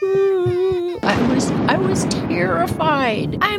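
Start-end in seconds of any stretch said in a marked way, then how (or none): 0.0-1.0 put-on high, childlike scared whimper; mock child's frightened ooh. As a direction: whimper in fear, curled up small. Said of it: "and just like".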